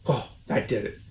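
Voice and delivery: ordinary delivery, nothing marked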